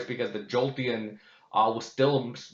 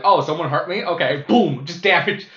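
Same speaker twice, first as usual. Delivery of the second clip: tough attitude; 4 semitones higher, 6 dB louder than usual. Retold or imitated. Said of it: "this is how I usually feel".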